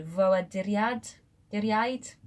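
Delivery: "Ffoaduriaid" slowly in Welsh, repeating "Duriaid"; first try at a Welsh word; careful Welsh. Shown as a demonstration. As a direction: sound out the unfamiliar word slowly. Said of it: "I've never said that word in my life".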